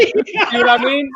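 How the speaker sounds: cracking up while asking; laughing. Real, not acted. Laughing at friends' reaction.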